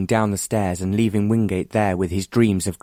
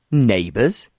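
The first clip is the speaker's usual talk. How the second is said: scornful, incredulous echo; sneer; 3 semitones higher than usual. A narrator's reading. Wingate scorning John's talk of neighbours, sneering. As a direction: throw the word back with a sneer. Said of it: "Wingate sneered".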